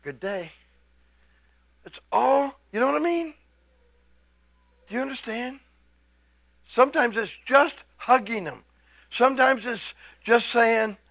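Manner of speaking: ordinary talk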